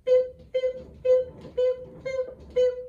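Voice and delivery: bouncy high boop boop to the beat; disco dance sounds. Shown as a demonstration. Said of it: "And you dance like this".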